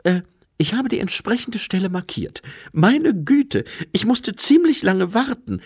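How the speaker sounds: high, flustered self-justifying voice; embarrassed angel. A narrator's reading. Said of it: "Die Gestalt wirkte verlegen".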